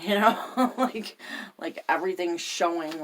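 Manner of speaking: real laugh breaking into the telling. Real, not acted. Laughs while recalling being exposed.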